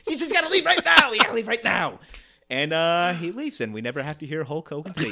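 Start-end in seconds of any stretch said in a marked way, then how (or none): 0.1-2.0 loud, high, frantic mad scientist; Doc Brown impression. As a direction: warn him like a panicked Doc Brown. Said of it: "another Christopher Lloyd".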